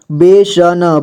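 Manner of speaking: बेसन drawn out, final न sounded separately; syllable by syllable. Shown as a demonstration. Teacher showing learners how to read बेसन, syllable by syllable. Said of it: "जोड़ जोड़ करके".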